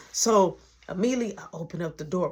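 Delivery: ordinary talk, nothing marked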